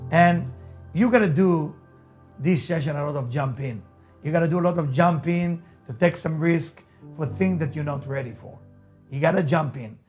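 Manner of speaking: usual speaking voice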